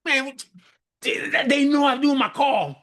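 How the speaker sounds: high, pleading mock complaint, rising to a shout; exasperated self in the moment. To kids knocking during a call, a high exasperated whine. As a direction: whine it out like you're being set up to cry. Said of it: "You be like".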